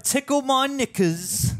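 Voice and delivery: mock London accent. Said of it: "That's what they say in London".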